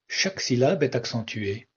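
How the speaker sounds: ordinary talk, nothing marked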